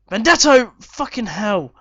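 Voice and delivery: very loud high yell, then curse; excited shout. Real, not acted. Gamer shouting at a last chance before half time. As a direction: scream the name as the ball misses.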